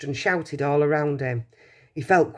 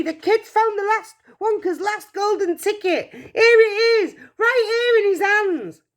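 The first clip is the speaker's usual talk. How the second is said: wild, much higher and louder shouting; excited shout; 5 dB louder than usual. Acted. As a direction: yell to the whole shop, beside yourself.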